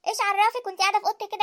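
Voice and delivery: usual speaking voice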